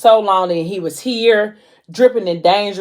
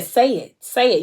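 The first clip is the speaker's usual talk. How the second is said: insistent, higher-pitched demand; acted urging; 5 semitones higher than usual. Man pressing Mo to admit her feelings, urging.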